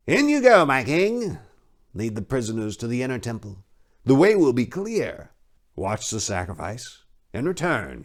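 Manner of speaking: high, oily priest voice; a leering, smug high priest; sly high priest. Acted. High priest placating the king's men, high and oily.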